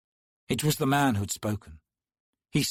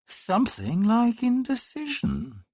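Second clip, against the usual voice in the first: put-on cool, detached, higher young man's voice; cool stranger's voice; 12 semitones higher than usual. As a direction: drop it in coolly from above. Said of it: "a voice said coolly".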